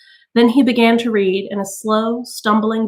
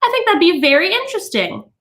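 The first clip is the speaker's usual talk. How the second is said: high, prim lady's voice; high polite hostess tone; Mrs Phelps; 10 semitones higher than usual. A narrator's reading. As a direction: sound politely curious.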